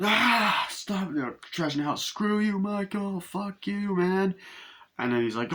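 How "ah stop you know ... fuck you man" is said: high-pitched mock yelling of angry kids; rowdy kids yelling. Kids trashing the house and cursing Michael, mock yelling. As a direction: holler in a raised, hammed-up kid voice. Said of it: "there's all these kids going".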